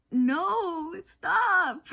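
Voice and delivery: loud high outburst; frantic shout of stop. Real, not acted. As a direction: yell it in protest. Reacting to spoiler, shouting.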